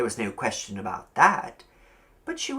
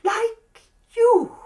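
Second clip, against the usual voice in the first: put-on high, louder coy old landlady; 5 dB louder than usual. A narrator's reading. Landlady eyeing young Billy, high and coy. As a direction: purr it with a sly sweetness.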